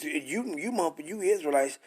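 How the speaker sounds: ordinary delivery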